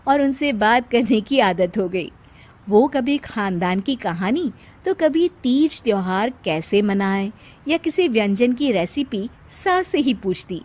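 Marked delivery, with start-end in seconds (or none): none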